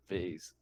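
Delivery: "vase" with a long ay vowel; "vase" said softly to rhyme with "phase"; pronounced "vayz". Real, not acted. Repeats the corrected pronunciation.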